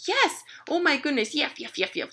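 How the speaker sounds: high yappy puppy voice; excited puppy. Acted. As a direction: gush and yap as the thrilled dog. Puppy gets excited outside.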